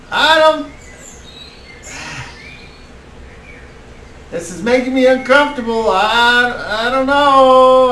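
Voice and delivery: high-pitched, uneasy character voice; Kettle Tom. Acted. Game master as a reluctant party member, uncomfortable high voice.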